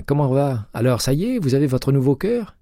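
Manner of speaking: voix de Jay plus aiguë, enjouée; Jay au téléphone. A narrator's reading. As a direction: interlocutrice jouée un ton plus haut, légèrement animée. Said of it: "dit-elle enfin". (Jay, inspectrice, répond à McCaleb au téléphone, voix plus haute)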